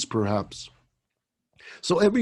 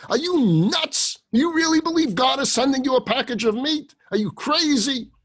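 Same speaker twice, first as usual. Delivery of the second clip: louder and higher, incredulous rebuke; angry scolding; 10 semitones higher, 6 dB louder than usual. Acted. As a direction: scold him in disbelief. Rabbi scolds the shamash.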